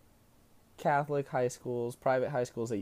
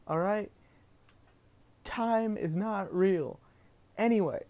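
usual speaking voice

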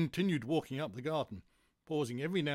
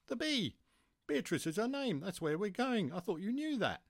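lightly voiced character, pitched higher; a higher-pitched explaining tone for a story figure; exasperated story character; 9 semitones higher than usual.

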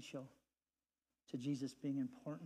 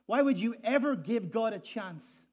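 sceptical challenge; 7 semitones higher, 12 dB louder than usual. Retold or imitated. Quoting a sceptic outside church.

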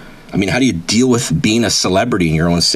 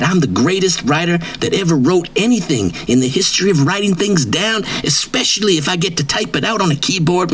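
pitched-up, swaggering braggart voice; cocky wrestler persona; 8 semitones higher than usual. Acted. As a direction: brag like a washed-up wrestling star who thinks he's famous. Persona brags about writing.

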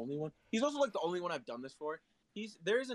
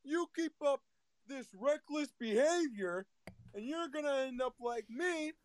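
Chris Farley's motivational speaker; 8 semitones higher than usual. Acted. Skit speaker warning youngsters, hammed-up motivational speaker. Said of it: "you can make that impression".

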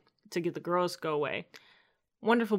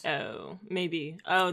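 ordinary delivery

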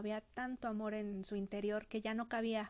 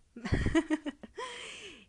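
whispered laugh; 9 semitones higher, 3 dB louder than usual. Real, not acted. Laughs at her own joke.

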